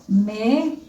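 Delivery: "mesa" spaced out as me... sa; syllable by syllable. Shown as a demonstration. Teacher writes the word for pupils, syllable by syllable. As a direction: dictate "mesa" one syllable at a time. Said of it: "dos golpes de voz".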